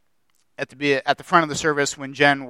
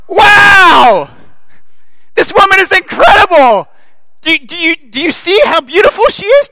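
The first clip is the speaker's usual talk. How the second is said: much higher, shouting, giddy with delight; God overjoyed; 10 semitones higher, 9 dB louder than usual. Acted. God marveling at his bride-to-be, shouting with joy. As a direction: gush like a thrilled groom. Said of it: "what God was probably doing".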